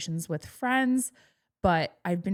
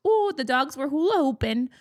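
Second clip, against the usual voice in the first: higher-pitched, thick Wisconsin impression; Wisconsin accent; 7 semitones higher, 4 dB louder than usual. Quoting mother-in-law about the dogs, thick Wisconsin accent. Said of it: "she has a Wisconsin accent".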